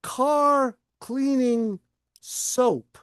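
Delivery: drawn-out request. Retold or imitated. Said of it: "And they say".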